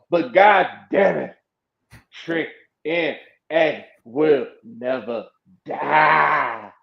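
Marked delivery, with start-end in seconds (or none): 2.2-6.7 low, slow "Trick N A will never die", word by word; weighty, drawn-out vow on "never die"; solemn declaration. Acted. Solemn declaration about the wrestler.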